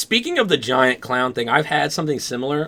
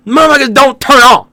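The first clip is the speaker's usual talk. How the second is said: high, loud exasperated yell; freaking out; 10 semitones higher, 10 dB louder than usual. Retold or imitated. Dreamer retelling lights that won't turn on, freaking out. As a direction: yell it in mock panic at the dead switch. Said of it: "And I'm freaking out here".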